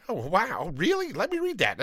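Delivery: imitating a reader's amazed jump up in pitch; surprised and eager. New Bible reader discovering a story, imitated with surprised eagerness. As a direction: play a newcomer stunned and eager to read. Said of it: "They're like".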